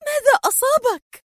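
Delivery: very high, indignant cry; high-pitched, hurt protest; exasperated and pained. A narrator's reading. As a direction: cry out in anger and pain. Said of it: "تهتف في مزيج من الحنق والألم".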